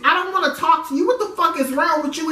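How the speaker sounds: woman hiding fear behind rapid anger; defensive. Retold or imitated. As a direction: snap back, hide the fear. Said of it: "my thing is to get defensive".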